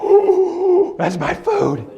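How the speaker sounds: very high loud ooh then eager dog line; a dog hearing its dinner; squealing happy dog; excited dog voice. Pretend. Dog hears food in bowl.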